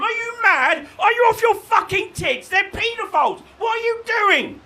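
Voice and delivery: high, loud outraged yelling; shouting. Retold or imitated. The left yelling at voters, furious shout. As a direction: scream at the voters in disbelief. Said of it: "the left shouting".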